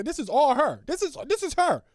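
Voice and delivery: loud, high exclamation; excited, squealed accusation; insistent conviction. Real, not acted. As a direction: call it out with rising certainty. Excited verdict on the statement.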